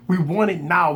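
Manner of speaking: normal talking voice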